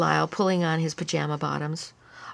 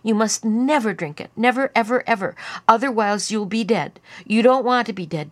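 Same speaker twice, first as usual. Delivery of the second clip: put-on louder, higher, insistent child; emphatic warning; 5 semitones higher, 6 dB louder than usual. A narrator's reading. Lyle warning Melody off the poison, emphatic. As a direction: drive home each "never, ever".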